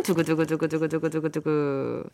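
low drumroll sung with the mouth; drumroll imitation. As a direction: rattle off a mock drumroll. Drumroll before answer.

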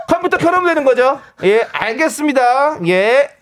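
high, carrying confirmation on the phone. Acted. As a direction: announce it so the boss hears. Coworker loudly exposes secret call.

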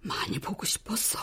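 a soft, hushed "I missed you"; tender whisper. Acted. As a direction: whisper it lovingly to your daughter. Mother's tender confession. (다정한 속삭임)

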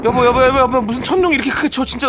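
cling to the husband in mock fear; hammed-up fright. Wife scared by thunder. (천둥에 놀라 과장되게 무서워하는 아내)

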